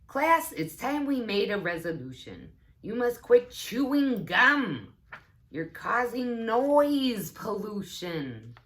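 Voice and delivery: a schoolteacher scolding her class about gum; stern teacher. Acted. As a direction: lecture the class sharply. Teacher Miss Trachodon scolds.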